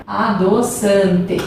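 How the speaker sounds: slow, syllable by syllable; slowly spelled-out word; drawn-out word. Shown as a demonstration. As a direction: stretch out each syllable as you write. Spelling out the word while writing.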